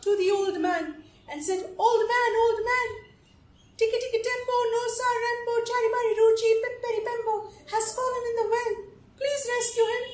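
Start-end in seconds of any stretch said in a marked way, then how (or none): 1.8-10.0 put-on high-pitched child calling for help; urgent child. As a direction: plead urgently in a raised little voice. Child runs to old man for help.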